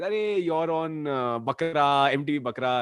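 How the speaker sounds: ordinary talk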